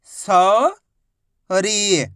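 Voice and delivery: सौरी split into सौ, long pause, री; syllable by syllable. Shown as a demonstration. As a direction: stretch सौ upward, pause, then a falling री. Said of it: "तो मिलके बन जाएगा".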